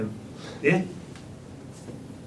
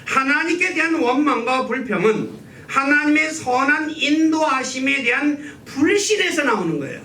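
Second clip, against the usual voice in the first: usual speaking voice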